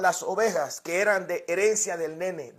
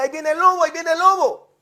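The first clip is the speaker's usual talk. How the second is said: put-on boy crying wolf; 5 semitones higher, faster than usual. Boy's false cry of wolf.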